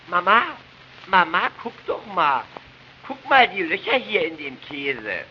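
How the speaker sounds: put-on high, begging little boy voice; whiny child. Boy tugging at mother about cheese holes, high and whiny. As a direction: pester like a small child.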